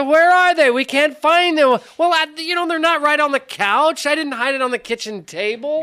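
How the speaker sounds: high-pitched acted-out squabble; exasperated retelling. Father replaying the hunt argument with his sons, exasperated retelling.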